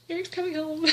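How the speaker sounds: choked up with real tears of joy; tearful. Real, not acted. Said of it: "I'm getting so emotional".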